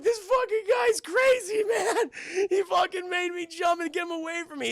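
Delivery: very high, loud mock wail; hysterical complaint. Rescued jumper pleading about the crazy cop, high hysterical wail. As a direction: cry out as if scared witless.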